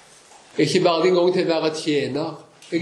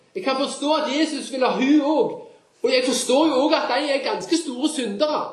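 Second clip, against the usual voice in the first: raised, earnest preacher's voice; fervent preaching; 10 semitones higher than usual. Acted. Preacher urging the congregation, raised and fervent. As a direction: press the gospel home to the congregation.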